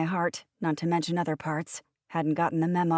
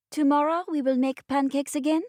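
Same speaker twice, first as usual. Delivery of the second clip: put-on high, eager little French boy; child delighted with his picture; 9 semitones higher than usual. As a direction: voice a small child proudly showing his drawing. Child Kristoff voiced.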